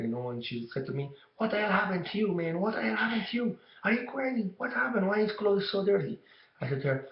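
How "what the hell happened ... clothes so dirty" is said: much higher pitched retelling of mom's alarmed scolding; shocked scolding mom. Retold or imitated. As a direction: play the horrified mother, voice jumping up high. Mom reacts to dirty son.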